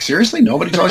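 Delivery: high, rising disbelief; incredulous. Retold or imitated. Colleague on the phone, high, incredulous. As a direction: ask it like you can't believe nobody told him. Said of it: "I think he was a bit incredulous".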